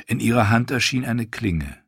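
ordinary delivery

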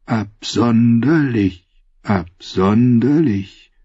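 ghostly slow singsong; whispering reeds' voice. Acted. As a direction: stretch each word out eerily. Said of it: "Doch das Schilf sagte".

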